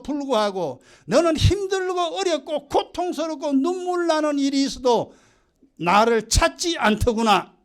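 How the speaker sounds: loud, high, lamenting divine rebuke; God reproaching people who never sought him; God rebuking. Retold or imitated. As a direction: pour out God's grief and reproach. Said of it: "이게 하나님 말씀이에요".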